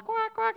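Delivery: mock duckling quacking; imitated quick duck quacks.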